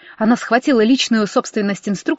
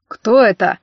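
cool, contained question; icy restraint; cold; slower than usual. A narrator's reading. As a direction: ask it coldly, without rising to the row. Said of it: "холодно поинтересовался Демьян".

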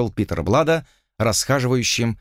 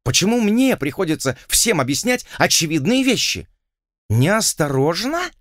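contemptuous; 7 semitones higher than usual. A narrator's reading. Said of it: "презрительно переспросила Арабелла".

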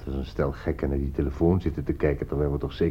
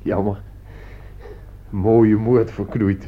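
mock disappointment; 3 semitones higher, 4 dB louder, much slower than usual. Mock regret at spoiled murder.

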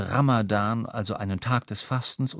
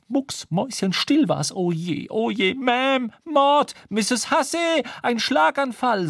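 much higher, louder shrieking chambermaid; panicking maid; 11 semitones higher, 4 dB louder, slower than usual. Acted. Chambermaid calling the landlady in panic, shrill.

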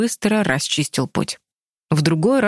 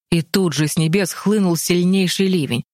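usual speaking voice

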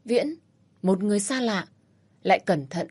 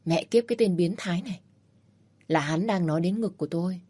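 ordinary delivery

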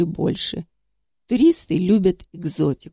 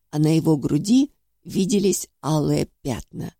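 plain reading voice

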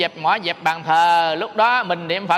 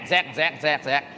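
shuffling sound; 4 semitones lower, 4 dB quieter than usual. Retold or imitated. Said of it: "đi sàn xẹt".

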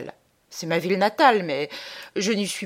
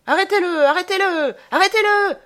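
high, full-force repeated yell; crowd shouting; 13 semitones higher, 7 dB louder than usual. A narrator's reading. Crowd crying out after a fugitive in sudden commotion, high shouting. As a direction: cry out like a crowd in pursuit, pitched way up. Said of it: "on crie, sur tous les tons".

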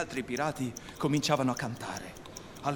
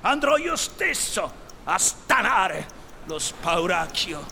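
loud, high outburst slowing down; menacing resolve; 5 semitones higher, 5 dB louder, slower than usual. A narrator's reading. Captain will face the bogey himself, menacing declaration. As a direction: burst out high and loud, then drag the words menacingly. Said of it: "alzò minaccioso il moncherino".

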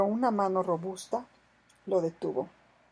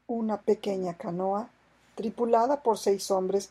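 ordinary delivery

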